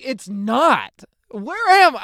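high, loud, retold disbelief; a teen at breakfast hearing the dad talk, squealing in panic; mock panic. Panicky shriek of disbelief. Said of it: "I was just terrified".

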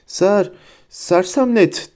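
high, slow, halting spell cry; shouted spell. A narrator's reading. Hermione casting as she catches up, shouting. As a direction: call it out slowly, catching on the first syllable. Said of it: "diye bağırdı".

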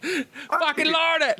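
failed high-pitched Australian attempt; badly faked Australian; Australian accent. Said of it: "in the Australian accent".

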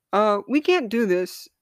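high, loud holler. A narrator's reading. Mind hollers at body, loud and high. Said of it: "mind hollered".

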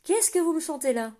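loud, high, strained call; distressed voice. A narrator's reading. Zilla calling up to the guard, anxious and strained. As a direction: cry out, anxious and breathless. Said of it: "la voix oppressée".

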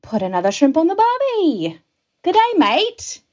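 high, hammed-up Australian accent; exaggerated Australian greeting voice. Shown as a demonstration. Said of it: "How about an Australian accent?".

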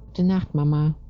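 put-on high-pitched child voice; little girl. A narrator's reading. Little girl saying goodnight to Mama, high child voice. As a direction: say it as a small girl, pitched well up. Small girl answers her mother.

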